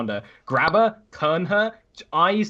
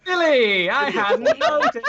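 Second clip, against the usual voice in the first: very high, louder laughing retort; laughing sarcasm; 6 dB louder than usual. Real, not acted. Player answering teasing about [name] not being wise, laughing sarcasm. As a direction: squeal it sarcastically through real laughter.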